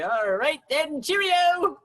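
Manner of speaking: a breezy British send-off, slowed down; British accent. Acted. British cereals brushing off the maître d', in a British accent. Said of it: "the British cereals look at each other".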